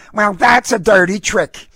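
high, loud feisty woman; indignant dog owner. A narrator's reading. Dog owner objects to the registration fee, indignant.

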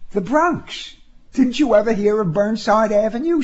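high female voice, lightly amused. A narrator's reading. Woman teasing her driver about Burnside Avenue, amused. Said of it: "she laughed".

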